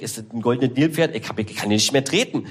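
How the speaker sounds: ordinary delivery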